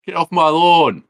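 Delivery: loud, high-pitched imitation of a man yelling; mock yell. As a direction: holler it across the yard, mimicking an annoyed homeowner. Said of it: "and S P L's like".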